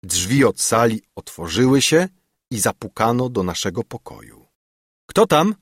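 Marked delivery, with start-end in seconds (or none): 5.1-5.6 high, loud challenge at the door; raised-voice question; loud call. A narrator's reading. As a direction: call out sharply to whoever knocked. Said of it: "zawołałem głośno".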